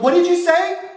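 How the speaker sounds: loud, high accusing question; stern mom demand. Retold or imitated. Mom hears a curse from another room, loud demand. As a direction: call it sharply from the other room.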